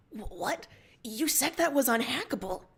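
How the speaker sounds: breathless, shocked disbelief; gasping shock. Acted. Crewmate hears the hack news, gasps in shock. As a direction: gasp it out in alarm. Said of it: "you gasp".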